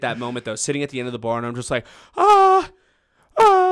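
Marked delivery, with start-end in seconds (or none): 2.2-3.6 high, loud drawn-out "ah"; mock panicked yell. Scared server rushing to the fight, mock panicked yell. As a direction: re-enact a helpless war cry. Re-enacts his cry charging in.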